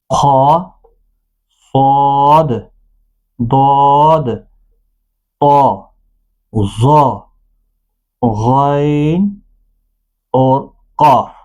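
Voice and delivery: "خا، صاد، ضاد" thick, rounded and drawn out; full-mouthed heavy letters. Shown as a demonstration. Teacher listing seven heavy letters, "صاد" thick and held. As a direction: give "خا" and "قاف" a heavy back-of-mouth sound. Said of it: "پر پڑھا جاتا ہے".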